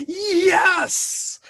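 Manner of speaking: high, loud, drawn-out yes; triumphant fan outburst, retold; excited cheer. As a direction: re-enact leaping up to cheer. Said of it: "I literally stood up and went".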